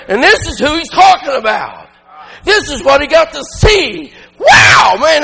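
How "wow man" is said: high shouted wow; soaring shouted wow of delight; excited wow. Real, not acted. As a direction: burst out in real excitement. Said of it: "that's exciting to me".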